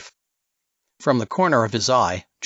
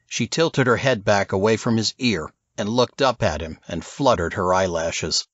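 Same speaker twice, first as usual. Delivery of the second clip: ordinary delivery, nothing marked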